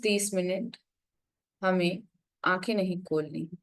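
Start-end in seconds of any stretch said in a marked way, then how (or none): none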